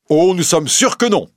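high-pitched emphatic exclamation; examining magistrate bursting in with certainty; exclaiming. A narrator's reading. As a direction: cry it out, insistent and high. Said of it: "s'écria le juge d'instruction".

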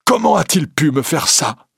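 high, anguished moan. A narrator's reading. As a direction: wail it in wounded disbelief. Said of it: "et gémit".

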